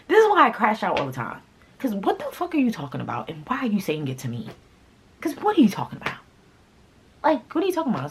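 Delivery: high, exasperated rant. Real, not acted. Retelling the accusation to viewers, high exasperated rant. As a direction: vent the frustration all over again.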